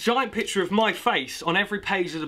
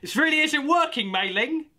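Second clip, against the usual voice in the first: Gary; 11 semitones higher than usual. Acted. Gary's line continues.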